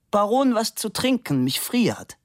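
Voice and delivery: high-pitched sudden demand; demanding model. A narrator's reading. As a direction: call out high for a drink.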